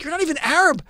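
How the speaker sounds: re-enacted heated protest; indignant retort. Acting out retort to critics.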